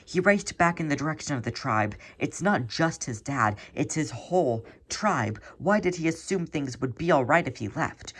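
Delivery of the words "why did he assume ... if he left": usual speaking voice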